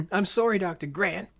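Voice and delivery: put-on high, whiny kid's apology; mock exclaiming. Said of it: "they exclaim way more".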